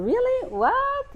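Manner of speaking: high-pitched, disbelieving questions; astonished, voice shooting up. Retold or imitated. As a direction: squeak both words in surprise. Shocked that they only talked.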